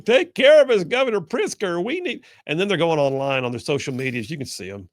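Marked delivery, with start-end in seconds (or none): 0.1-2.2 much higher, louder mock plea; pleading Democrats. Host mocking lawmakers begging the governor, high pleading whine. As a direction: beg like a helpless child to a protector. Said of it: "fleeing to Governor Pritzker's".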